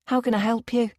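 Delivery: chirpy receptionist answering the phone; cheerily. Acted. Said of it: "she asks cheerily".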